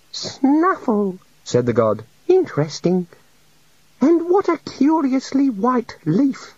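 high meek little god. A narrator's reading. The little god confesses to the wizards, meek and high. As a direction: play a shy, sorry deity.

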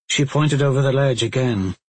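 usual speaking voice

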